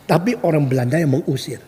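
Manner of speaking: usual speaking voice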